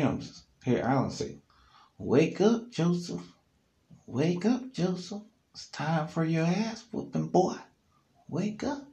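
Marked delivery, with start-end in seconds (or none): 2.0-8.9 high, teasing singsong menace; taunting wake-up. Retold or imitated. Alan taunting sleeping Joseph, high mocking singsong. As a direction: tease him awake before the beating.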